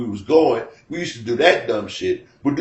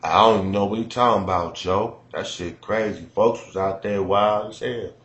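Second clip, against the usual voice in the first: low, soft, fast Chicago mumble; detached, low Chicago street talk; 4 semitones lower, 4 dB quieter than usual. Retold or imitated. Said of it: "If you ever listen to dude talk".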